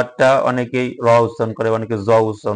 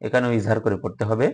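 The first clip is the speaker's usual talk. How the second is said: usual speaking voice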